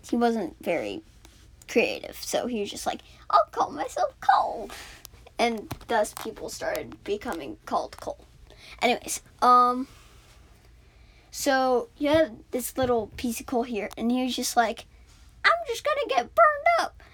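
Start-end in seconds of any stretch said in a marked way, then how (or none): 3.3-4.7 much higher, small character voice; squeaky coal voice. Acted. Coal piece names himself in a high voice. 15.4-17.0 much higher, small character voice; squeaky coal voice. Acted. Coal piece names himself in a high voice.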